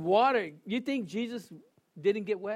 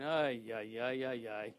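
mock dismay; 9 semitones lower, 9 dB quieter than usual. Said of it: "and I'm thinking".

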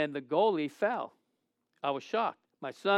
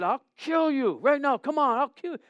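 higher pitched mock threat; retold threat; 6 semitones higher than usual. Goalie taunting the brother, high mock threat. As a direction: taunt him to fight.

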